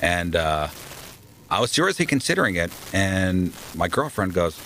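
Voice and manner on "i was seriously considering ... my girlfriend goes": ordinary talk, nothing marked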